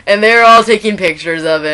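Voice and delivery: ordinary delivery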